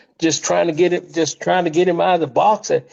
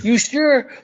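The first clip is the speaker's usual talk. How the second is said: pitched way up, anxious and pleading; hammed-up worried question, much higher; mimicking a nervous Pat; 11 semitones higher than usual. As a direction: ask it like you're sure this will end badly. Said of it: "He kept, just kept asking me".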